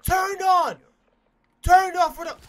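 high, loud outburst; excited shouting. Real, not acted. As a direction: yell it out, hyped by the play. Scores a big play mid-game.

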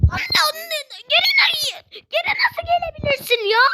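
high laughing shriek of disbelief. Real, not acted. Gamer outraged at the level, laughing shriek. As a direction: cry out, half laughing, outraged.

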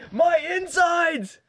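mock scream. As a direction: fake agony, stretched slow and high. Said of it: "inside he's just screaming".

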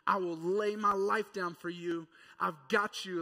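loud, proud promise; big eager boast; boastful vow. Acted. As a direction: puff up and declare it. Peter boasting loudly.

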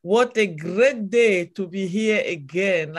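ordinary talk

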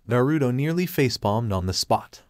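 plain reading voice

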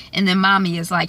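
ordinary delivery

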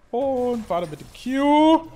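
laut und sehr langsam gedehnt. Real, not acted. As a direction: zieh es laut in die Länge. (laut, sehr langsam gedehnt)

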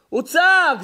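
high, loud cry; shouted. Shown as a demonstration. Preacher shows how Noah yelled at people, loud high shout. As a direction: burst out at full voice. Preacher acts out Noah's yelling.

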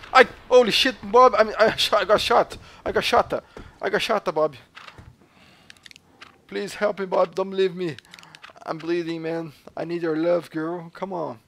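hammed-up panic after being shot; panicked. Real, not acted. Just got shot in game.

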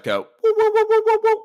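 high whooping siren noise; scanner whoop imitation. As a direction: mimic the med-scan beeping.

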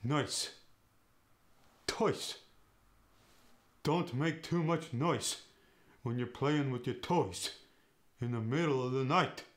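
"Noise." said "nice", with no lip rounding; a Yiddish parent scolding about noise. Shown as a demonstration. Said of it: "noise and toys go to".

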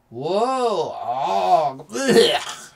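wobbly swooping whoa into a loud blech; hammed-up queasy moans; dizzy groan. A narrator's reading. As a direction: groan as if shaken about. Bob shaken dizzy, groaning.

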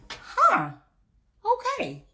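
high, squeaky huh then okay; a high, startled huh, okay; surprised okay. Retold or imitated. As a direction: caught off guard, then give in. Grudgingly accepting husband's name.

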